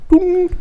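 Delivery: high, sung chime before an announcement; high held chime tone; imitated cabin chime. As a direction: imitate the plane's intercom ding.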